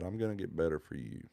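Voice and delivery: real emotion catching in the throat; choked up. Real, not acted. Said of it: "I'm gonna get choked up".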